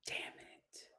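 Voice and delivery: whispered curse under her breath; frustrated whisper. Real, not acted. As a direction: hush it out in defeat. Whispered frustration over missed store.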